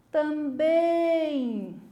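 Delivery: drawn-out call with BÉM held long; long, ringing final syllable on "também". Shown as a demonstration. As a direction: call "também" slowly, stretching the last syllable. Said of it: "Eu vou gritar".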